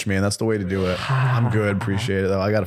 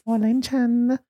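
mock anime girl. Mocking his friend with a high cooing anime girl. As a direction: squeal it like a lovestruck cartoon girl. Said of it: "I'm allowed to do that accent".

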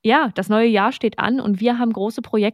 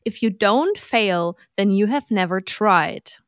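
reading aloud; slower than usual. Shown as a demonstration. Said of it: "ich muss das mal kurz auf Englisch vorlesen".

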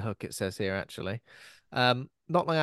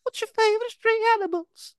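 mock outraged squeal; 11 semitones higher, 4 dB louder, faster than usual. Mock outrage at silly question.

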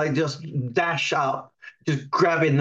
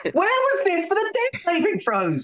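indignant whine; 14 semitones higher than usual. Acted. Player outraged at GM's roll.

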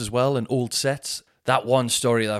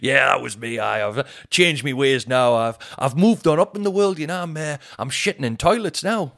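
Geordie mate; 4 semitones higher, 5 dB louder than usual. Retold or imitated.

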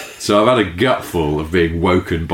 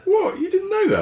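surprised. Real, not acted. Surprised he didn't know.

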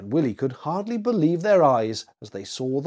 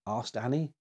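ordinary delivery

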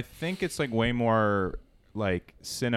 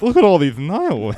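high, loud lisping guy; 8 semitones higher, 8 dB louder than usual. Retold or imitated. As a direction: lisp hard through every s. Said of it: "like, a guy with a lisp says nihilists".

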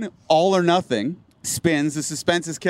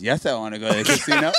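imitating drunk Greg, lower, fast, laughing; 7 semitones lower, much faster than usual. Drunk Greg answering Max about the casino, lower, fast, laughing. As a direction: blurt it out like a hammered friend, laughing. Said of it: "And then Greg goes".

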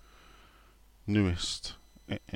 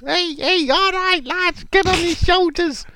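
very high, squeaky mock coach; Kevin Nolan. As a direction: squeal the order along to the lads. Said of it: "Kevin Nolan at the sideline".

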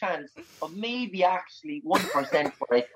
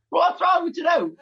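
high, raised-voice bewilderment; exasperated high-pitched query; baffled protest; 9 semitones higher, 3 dB louder than usual. Real, not acted. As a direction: demand an explanation, pitch jumping up. Baffled guest reacting to host's hysterics.

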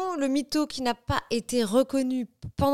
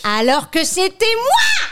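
triumphant exclaim; 9 semitones higher, 8 dB louder, slower than usual. Real, not acted. Reveals she was the liar.

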